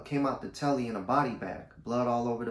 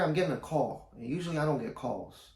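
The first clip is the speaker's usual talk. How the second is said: ordinary delivery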